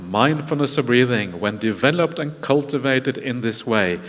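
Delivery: solemn scripture quote. Acted. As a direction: deliver the Buddha's words with weight. Quotes the Buddha's closing words.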